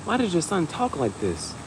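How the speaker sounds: mock a prissy principal; school staff. A hint of the principal scolding the parent. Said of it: "They be like".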